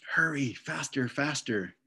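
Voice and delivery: breathless hushed urging; hurried whisper under the breath; urgent whisper. Acted. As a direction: whisper it fast, pushing hard.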